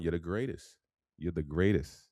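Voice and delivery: usual speaking voice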